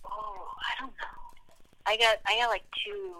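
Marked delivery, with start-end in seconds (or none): 0.1-1.2 slow, breathy whisper of not knowing; real hushed uncertainty. Real, not acted. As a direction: whisper it to yourself, stalling. Unsure over a hard question.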